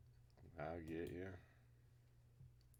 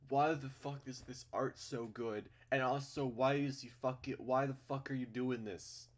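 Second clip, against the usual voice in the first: excited outburst; 6 semitones higher, 8 dB louder than usual. Real, not acted. Reacting to the art.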